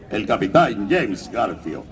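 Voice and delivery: voz impostada de canto, lenta y grave; capitán pirata pomposo, bajando el tono; James Garfio. Shown as a demonstration. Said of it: "es una impostación de canto". (voz grave impostada de Garfio)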